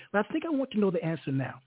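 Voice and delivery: ordinary delivery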